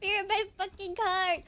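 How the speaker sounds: exasperated cry between laughs; laughing outburst. Real, not acted. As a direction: call out the cart thief mid-laugh.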